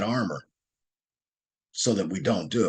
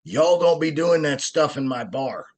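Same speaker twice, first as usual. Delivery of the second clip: higher, louder hillbilly boss yelling across the bar; retold yell; 6 semitones higher, 3 dB louder than usual. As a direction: bellow it like a big bar owner telling them off. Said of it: "he screams and he says".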